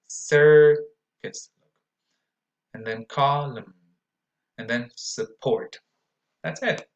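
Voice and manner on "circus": loud demo. Shown as a demonstration. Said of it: "word drill".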